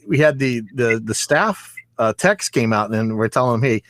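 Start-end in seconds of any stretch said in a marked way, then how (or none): none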